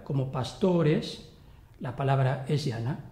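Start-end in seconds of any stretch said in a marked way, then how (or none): 0.3-1.3 pastores with a long, stressed O; long penultimate vowel. Shown as a demonstration. Teacher giving an example, long stressed O in pastores. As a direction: slowly stretch the O in pastores. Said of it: "tiene la vocal larga".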